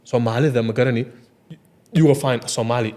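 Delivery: usual speaking voice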